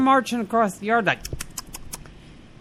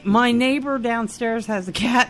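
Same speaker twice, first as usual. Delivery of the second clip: usual speaking voice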